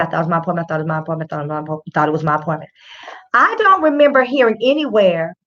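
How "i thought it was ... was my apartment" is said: low, muttered rote repetition; imitating Amber Geiger. Host quotes the officer's tape, low droning mock repetition. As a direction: run the excuse together in a low drone. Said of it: "Okay, she said".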